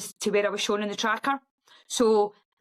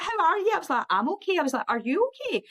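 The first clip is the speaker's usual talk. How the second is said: high, chirpy phone small talk; breezy chit-chat; 8 semitones higher, faster than usual. Retold or imitated. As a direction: play it cheerfully oblivious.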